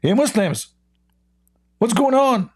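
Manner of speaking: high-pitched mocking hello; mock greeting.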